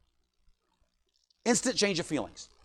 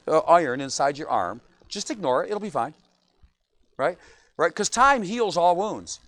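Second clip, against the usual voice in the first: normal talking voice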